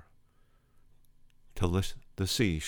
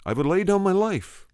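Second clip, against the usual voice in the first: retold vow soaring much higher; impassioned vow; 11 semitones higher, 3 dB louder than usual. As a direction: push the pitch right up, pleading fervently. [name] repeats his impassioned vow.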